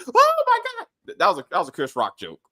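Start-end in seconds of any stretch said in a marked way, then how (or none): 0.1-1.2 very high, slow stretched exclamation; shocked. Real, not acted. Laughing about the joke, a high drawn-out oh my God. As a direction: squeal it out in disbelief.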